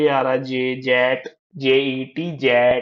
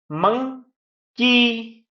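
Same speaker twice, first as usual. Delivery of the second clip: Monkey as two drawn-out beats, second higher; split into syllables; 7 semitones higher, much slower than usual. Shown as a demonstration. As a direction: break monkey after मं, hold each part. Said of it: "दो syllable भी होते हैं".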